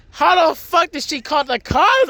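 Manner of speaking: high-pitched mock outburst of disbelief; shocked brother. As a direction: act out a brother reeling at the news. Brother's shocked reaction acted out.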